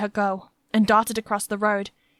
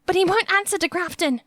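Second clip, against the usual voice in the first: put-on frightened girl; 10 semitones higher than usual. A narrator's reading. Frightened girl voiced by narrator.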